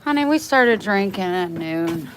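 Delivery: loud stern scolding of the dog; firm scolding. Real, not acted. Owner scolding her barking dog, loud and stern. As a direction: tell the dog off firmly.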